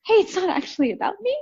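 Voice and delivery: high, quick aha moment; sudden realization. Retold or imitated. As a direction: rush out the light-bulb thought. Sudden realization quoted to herself.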